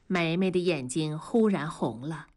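ordinary delivery

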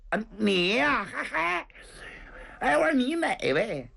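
high puzzled surprise; startled surprise. Acted. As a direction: caught off guard, half laughing. Zhang Fang meets his rescuer.

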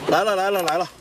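high, loud welcome call. Real, not acted. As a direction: shout a cheerful welcome. Greeting arriving guests.

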